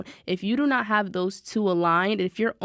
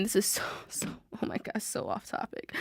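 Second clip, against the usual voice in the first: hushed breathy aside, barely voiced; whispered aside; 10 dB quieter than usual. Real, not acted. Podcast host whispers to herself about going off topic. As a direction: drop to a whisper for the side note.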